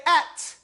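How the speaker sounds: "at" ending stopped on the t. Shown as a demonstration. Teacher showing how to stop, "at" closed on its consonant. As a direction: stop firmly on the end of "at". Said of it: "when you stop on it".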